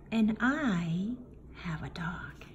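ordinary delivery, nothing marked

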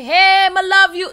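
high, loud overexcited fan greeting; a fan shrieking hello across the club, slow and loud; gushing fan. Retold or imitated. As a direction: squeal it like a starstruck fan. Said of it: "don't come and say".

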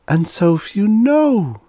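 high, loud, drawn-out lament; despairing. A narrator's reading. She laments to her companion how few know, despairing. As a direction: wail it slowly in despair. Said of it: "said Laloi despairingly".

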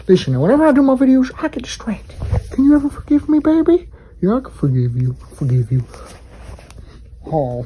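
normal talking voice

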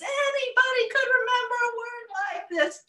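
high, fast, loud brag; boastful shout. Acted. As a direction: crow it out, sure of herself.

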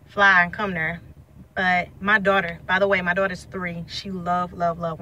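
ordinary talk

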